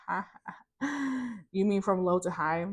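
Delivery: mock laugh, then a doubtful question; amused, doubting retort; scoffing disbelief. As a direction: brush it off as nonsense. Scoffing at the Lord's instruction.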